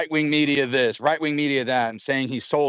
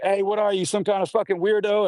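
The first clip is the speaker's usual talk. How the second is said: higher, taunting jailhouse heckle; mocking inmate challenge; 8 semitones higher than usual. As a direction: needle the new guy. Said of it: "And they're like".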